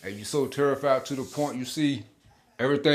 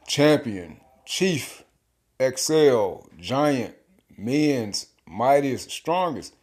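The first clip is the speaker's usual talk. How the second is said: loud, punched list of proud titles; preaching a list; 5 dB louder, much slower than usual. Acted. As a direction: hammer out each title like a charge.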